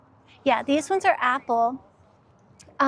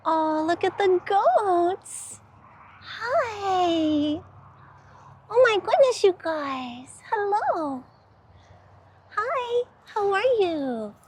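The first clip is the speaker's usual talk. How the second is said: cooing to animals; 6 semitones higher, slower than usual. Real, not acted. Woman greeting friendly goats, high cooing baby talk. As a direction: coo at them like sweet little pets.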